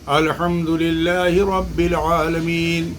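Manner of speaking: slow, drawn-out الحمدللہ رب العالمین with every letter pronounced; a teacher modelling the correct recitation, slowly; careful Quranic recitation. Shown as a demonstration. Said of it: "جیسے مثال کے طور پر آپ دیکھیے".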